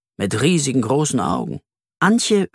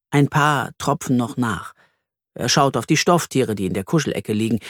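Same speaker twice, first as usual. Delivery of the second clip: plain reading voice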